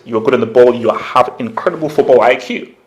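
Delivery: ordinary talk, nothing marked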